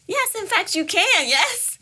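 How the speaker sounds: high, loud, emphatic exclaim; emphatic yes. Acted. Host answering girls' question, emphatic high exclaim.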